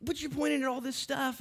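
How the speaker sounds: loud, high preacher's build; preaching at full force. Acted. Preaching climax.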